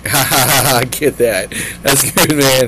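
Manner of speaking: big mock laugh, words riding on it; hammed-up laughing approval; Sonny laughing. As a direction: crack up loudly through the words. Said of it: "Sonny's like".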